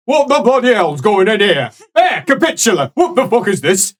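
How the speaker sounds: hammed-up grumpy priest voice; gruff angry old priest. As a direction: bellow like an outraged old cleric. GM voices angry Father NPC.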